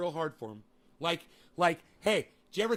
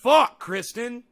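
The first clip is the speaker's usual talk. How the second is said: loud, higher frustrated cry; exasperated outburst; 6 semitones higher, 10 dB louder, much slower than usual. Real, not acted. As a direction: blurt it in disgust. Exasperated at commenter.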